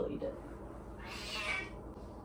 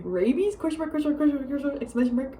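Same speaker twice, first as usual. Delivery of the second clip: higher-pitched, punctuation rattled off; mock-alarmed reading; 4 semitones higher, faster than usual. Reading out dad's text, mock-alarmed. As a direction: read out a text with amused disbelief.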